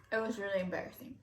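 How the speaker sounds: a quiet rushed echo of the words. Real, not acted. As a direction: mutter it again to yourself.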